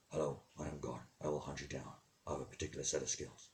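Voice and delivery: low, soft, menacing movie-hero threat; a quiet, deep threat like an action film hero; Liam Neeson voice. Pretend. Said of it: "a bit threatening, a bit melodramatic".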